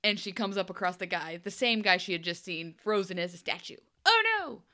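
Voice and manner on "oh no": high hammed-up "oh no"; mock alarm. Recapping the show, mock-gasps at the frozen man. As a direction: gasp it out in fake horror.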